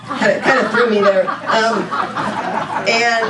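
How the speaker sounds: laughing through the words after a flub; embarrassed laughter. Real, not acted. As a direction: caught off guard, laughing it off. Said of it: "I really messed that up".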